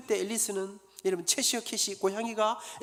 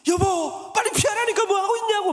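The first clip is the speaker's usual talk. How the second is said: much higher, much louder frantic scream at his fallen wife; desperate shout; 12 semitones higher, 10 dB louder than usual. Acted. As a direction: scream at her to get out of the way. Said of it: "막 외치는데".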